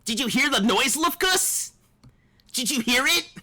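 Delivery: put-on eager young anime hero asking; anime protagonist voice. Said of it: "That anime protagonist voice".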